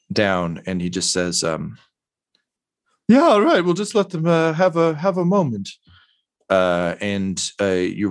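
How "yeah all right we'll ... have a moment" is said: higher, hesitant character voice; a worn-out man, pitched up and halting; weary, awkward character voice; exhausted, beaten-down man. Acted. Voices weary Mosifus Dirt.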